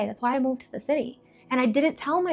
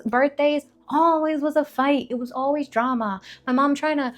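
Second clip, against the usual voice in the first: usual speaking voice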